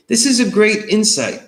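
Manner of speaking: high, loud, excited praise; light up with delight at the idea; enthusiastic praise. Real, not acted. Praising student's insight.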